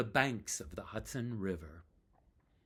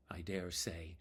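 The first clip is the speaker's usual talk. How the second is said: whispered aside; 5 semitones lower, 8 dB quieter than usual. Real, not acted. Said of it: "whisper measured".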